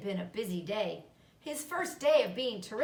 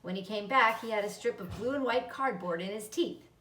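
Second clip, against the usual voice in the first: usual speaking voice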